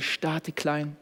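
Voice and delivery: "starte klein" said slowly and softly; "starte klein" breathed out gently, very slow; soft slow slogan. Shown as a demonstration. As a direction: let "starte klein" settle, slow and quiet. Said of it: "repeated slogan".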